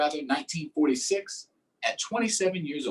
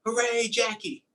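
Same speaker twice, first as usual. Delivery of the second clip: crowd cheer; 8 semitones higher than usual. Retold or imitated.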